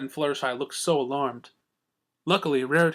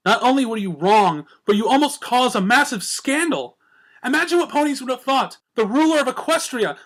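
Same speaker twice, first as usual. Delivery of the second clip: scolding Twilight; 10 semitones higher, 4 dB louder than usual. A narrator's reading.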